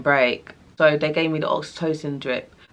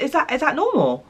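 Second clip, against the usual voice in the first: much higher, anxious asking; worried question; 10 semitones higher than usual. Retold or imitated. Worried mother asks the midwives, much higher. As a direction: plead for reassurance.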